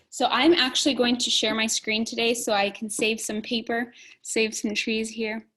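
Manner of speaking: ordinary talk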